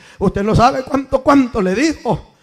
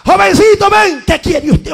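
higher, louder mock scolding; mock gruff elder; 9 semitones higher, 5 dB louder than usual. Preacher imitating an elder scolding a child, a touch mocking. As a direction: imitate the elder snapping at the boy.

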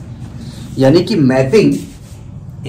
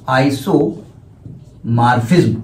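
slow dictation; 3 semitones lower, much slower than usual. Shown as a demonstration. Teacher dictating the term to students, drawn out slowly. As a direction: stretch every syllable, very slow. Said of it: "dictating the term slowly".

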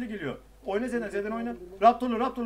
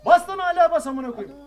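loud, higher frustrated command; urgent shouted order; 7 semitones higher, 7 dB louder than usual. Real, not acted. Player yelling at teammate to keep pressing, urgent shout.

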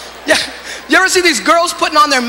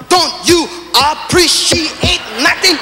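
very slow, every syllable of "appreciate" split, higher; syllables broken up; 7 semitones higher, much slower than usual. Retold or imitated. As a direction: hammer out each syllable of "Don't you appreciate nothing". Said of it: "she starts breaking up the syllables".

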